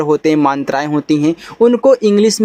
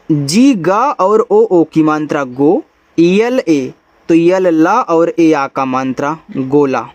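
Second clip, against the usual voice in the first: ordinary talk, nothing marked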